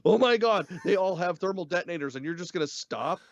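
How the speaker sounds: aghast reaction, played up; mock alarm. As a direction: exclaim it like you can't believe the danger. Voicing an alarmed reaction to danger.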